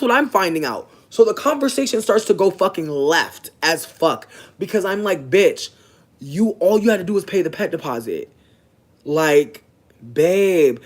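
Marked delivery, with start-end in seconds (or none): 5.3-10.8 louder, exasperated call-out; heated confrontation. Retold or imitated. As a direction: hit them with loud disbelief. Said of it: "I'm getting animated".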